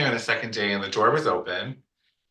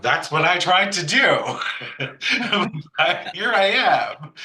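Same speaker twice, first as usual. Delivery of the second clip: high, loud sassy comeback with a laugh; mock-sassy quip, much higher and louder; sassy retort; 9 semitones higher, 6 dB louder than usual. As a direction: toss it back cheekily, then laugh it off. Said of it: "'cause I'm a bit sassy".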